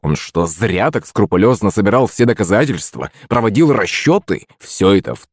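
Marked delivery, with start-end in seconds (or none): none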